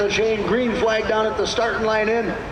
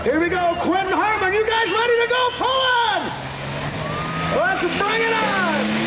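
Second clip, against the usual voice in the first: rising hype, higher and higher; excited; 8 semitones higher than usual. Real, not acted. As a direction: hype the crowd as the tractor launches. Launching the pull, calling it out.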